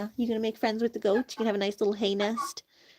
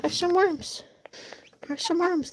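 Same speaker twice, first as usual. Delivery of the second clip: coaxing pet voice; 7 semitones higher than usual. Real, not acted. Coaxing chickens, higher.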